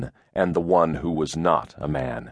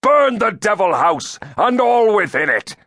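shouted command; 13 semitones higher, 6 dB louder than usual. A narrator's reading. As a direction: shout it to rouse a mob. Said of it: "he shouted".

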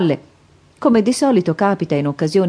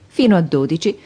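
ordinary delivery, nothing marked

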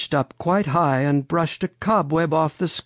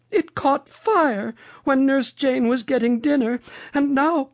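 high, grave storybook lady; Mother Goose; 13 semitones higher than usual. A narrator's reading. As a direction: deliver bad news in a very high voice. Mother Goose reveals the fire.

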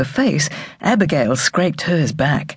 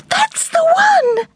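much higher, louder, slow delighted cry; the girl picks her new name, in a much higher excited cry; 4 dB louder, slower than usual. Acted. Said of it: "cried the girl".

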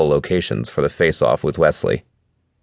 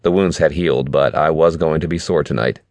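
ordinary delivery, nothing marked

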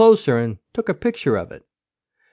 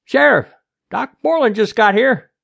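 raised, higher calling voice; yelled announcement; 8 semitones higher, 7 dB louder than usual. A narrator's reading. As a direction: call the news over to the boss. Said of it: "Tater yelled".

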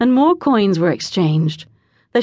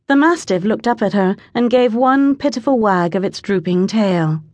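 plain reading voice

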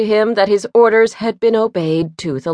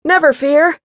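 confident comrade; 6 semitones higher, 4 dB louder than usual. A narrator's reading. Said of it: "said his fleet-footed comrade".

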